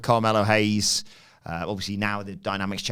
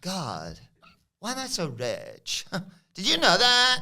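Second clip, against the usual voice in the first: Tiffany Stratton; 8 semitones higher, slower than usual. Retold or imitated. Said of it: "And she goes".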